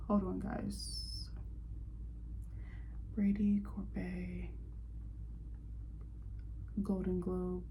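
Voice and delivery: slow whispered mutter while searching; muttering to herself. Real, not acted. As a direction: mumble under your breath, slowly, half to yourself.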